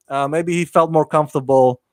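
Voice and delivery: ordinary delivery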